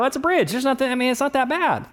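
high, shrugging "That's a bridge"; dismissive. Pretend. Driver shrugging off the bridge, high and dismissive. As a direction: brush it off, no big deal. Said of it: "you would kind of go".

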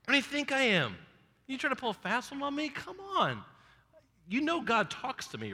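high, mock indignant prophet; incredulous Samuel. Samuel confronting Saul, high and mock indignant. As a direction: play Samuel calling out Saul's bluff.

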